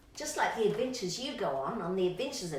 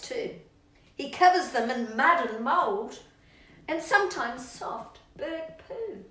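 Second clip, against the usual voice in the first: lively rhyming read-aloud, lightly dramatised; reading to children; 3 semitones higher, 5 dB louder, slower than usual. Acted. Reading a rhyming story to Rocky, lively and playful. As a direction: read it to a little one, relishing the yuck.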